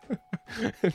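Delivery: short high-pitched laugh; quick breathy laugh. Real, not acted. Laughs at own state.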